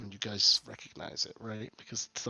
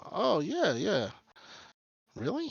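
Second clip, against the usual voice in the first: mock surprise; 10 semitones higher, 8 dB louder, slower than usual. [name] surprised.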